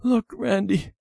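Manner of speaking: high, small crying voice; acted tearful little girl, pitched high. A narrator's reading. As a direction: confess as if close to tears. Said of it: "She was crying".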